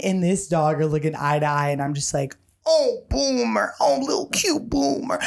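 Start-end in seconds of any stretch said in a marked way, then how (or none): 2.7-5.2 put-on baby talk to a dog. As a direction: coo at a cute puppy. Talking sweetly to the dog.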